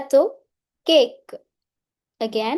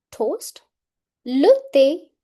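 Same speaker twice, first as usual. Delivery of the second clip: usual speaking voice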